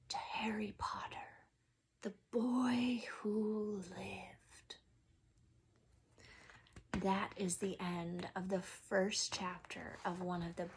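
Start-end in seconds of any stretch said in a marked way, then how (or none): 0.1-4.8 soft unvoiced whisper, very slow; hushed toast. Secret well-wishers toasting Harry, hushed whisper. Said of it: "saying in hushed voices".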